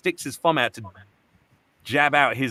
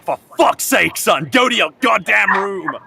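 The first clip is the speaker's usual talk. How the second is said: higher, louder furious dad shouting at his son; angry father yelling; 7 semitones higher, 6 dB louder than usual. Retold or imitated. Father yelling at son over spilled wine, loud angry. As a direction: bark it out like a fed-up father. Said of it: "his dad is like".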